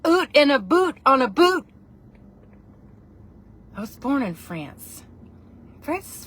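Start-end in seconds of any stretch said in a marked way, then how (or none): 0.1-1.7 loud, high Canadian "oot" and "boot"; mock Canadian accent. Shown as a demonstration. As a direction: exaggerate the Canadian vowels, punchy and loud.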